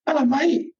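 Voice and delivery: high, breezy hello; cheery greeting. Retold or imitated. Greeting the man from the Facebook row, breezy and high. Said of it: "I was like".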